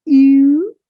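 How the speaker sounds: "U" as a long French u, loud and high. Shown as a demonstration. Teacher modelling the letter U, French vowel. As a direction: round "U" tightly from an ee. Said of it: "is quite like the letter Q".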